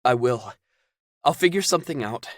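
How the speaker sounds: put-on high, loud urgent young man; alarmed Caden. Caden panicking, pressing Zelda on the phone. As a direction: demand the answer.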